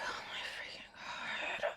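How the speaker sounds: exasperated whisper. Retold or imitated. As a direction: breathe it out in disbelief. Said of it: "Koji's just like".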